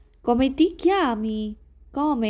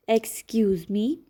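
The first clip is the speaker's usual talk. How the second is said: ordinary talk, nothing marked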